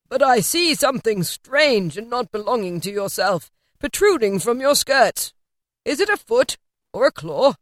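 a hint of an uneasy old man; Scrooge voice. A narrator's reading. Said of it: "said Scrooge".

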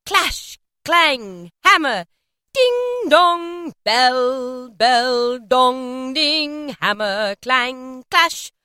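each word rung out like a bell; ringing, sustained bell imitation; pealing bells. A narrator's reading. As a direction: chime the words, loud then easing. Narrator imitates pealing church bells.

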